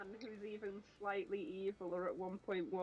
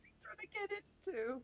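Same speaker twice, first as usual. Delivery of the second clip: pet talk; 11 semitones higher than usual. Real, not acted. Talking to cat, high.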